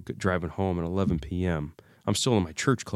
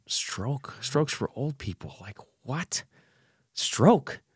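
disbelief; 6 semitones higher, much slower than usual. Retold or imitated. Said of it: "And I'm thinking".

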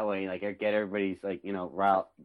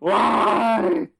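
mock anguished cry; 13 semitones higher, 14 dB louder than usual. Mock anguish after Red Wedding joke.

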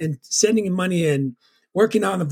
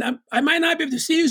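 put-on scared kid; 10 semitones higher, much faster than usual. Retold childhood talk with mom.

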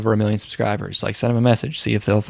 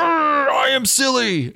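much higher, slow goofy voice; dopey straw man; 13 semitones higher, slower than usual. Retold or imitated. Comic's straw man admits he's silly, high slow goofy voice. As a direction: drag it out like a cartoon dimwit. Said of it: "And the guy's like".

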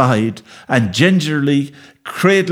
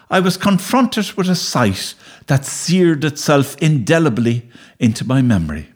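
usual speaking voice

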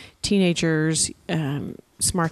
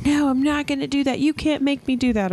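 mild mock yell; 8 semitones higher than usual. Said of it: "maybe screaming at us".

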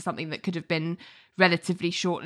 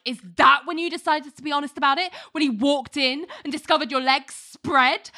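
imitated outraged cross-examination, fast and higher; mild retold yell, pitched up, no real volume; mock screaming; 6 semitones higher than usual. Mark tearing into Susan on the stand, mock screaming. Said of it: "Mark starts screaming".

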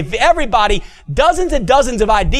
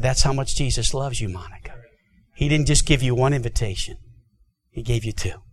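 tender hush; 7 semitones lower, 7 dB quieter than usual. Retold or imitated. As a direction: tell her gently how loved she is. Tender climax of the story.

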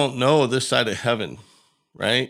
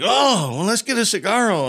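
high-pitched, louder retold cheer; excited cheer; 11 semitones higher, 4 dB louder than usual. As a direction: imitate a kid's excited celebration. Retold kid celebrating the birth.

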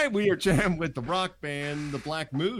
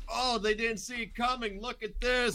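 mocking; 9 semitones higher than usual. Mocking edgy comedians' posts.

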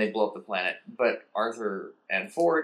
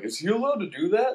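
much higher baffled question; puzzled Vogon; 10 semitones higher than usual. Acted. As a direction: dim bureaucrat confused.

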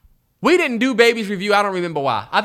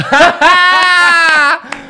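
loud, high burst of laughter; big laugh; 9 semitones higher, 8 dB louder than usual. Real, not acted. Cracking up at their own cookie story, loud high laugh. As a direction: crack up hard and long. Reacting to the joke.

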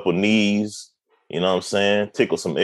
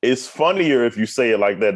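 ordinary talk

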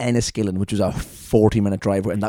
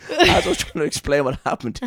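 normal talking voice

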